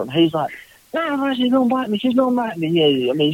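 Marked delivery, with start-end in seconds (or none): 0.9-2.7 high, panicky pleading in imitation; freaking out. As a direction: whine it like a man scared of a duck. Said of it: "he's freaking out".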